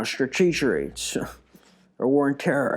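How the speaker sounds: light presidential impression; a loose, offhand take on a president; George Bush. Shown as a demonstration. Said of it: "I can actually kinda sound like him".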